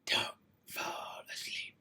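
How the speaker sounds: slow unvoiced whisper. Real, not acted. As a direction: breathe it out slowly. Whispered aside.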